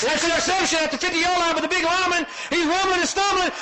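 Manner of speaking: shouting over the crowd as the lineman rumbles; excited play-by-play. Real, not acted. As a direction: call the big return at full excitement. Big interception return, live call.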